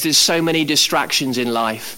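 usual speaking voice

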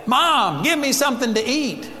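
put-on a kid calling to mom from the door; child asking mom. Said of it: "like a kid when they come into".

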